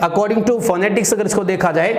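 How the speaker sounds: ordinary delivery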